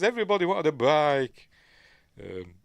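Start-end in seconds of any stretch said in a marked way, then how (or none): 0.0-1.5 loud, high comeback; mock exasperated retort. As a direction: throw it back loudly, pitched up. Retold retort to the bike question.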